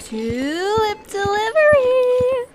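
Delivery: long, high, loud call at the door; cheery call. Acted. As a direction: sing it out to announce the delivery. Delivery person calling at door.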